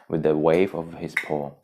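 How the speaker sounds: plain reading voice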